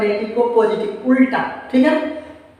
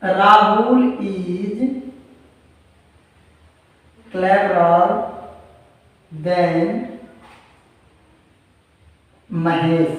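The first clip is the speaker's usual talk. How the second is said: very slow, word by word, long pauses between words; slow dictation; 2 semitones lower, much slower than usual. Shown as a demonstration. Teacher dictating the example sentence to students, slow word by word. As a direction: spell out each word as if students are writing it down. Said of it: "reads it again at normal pace".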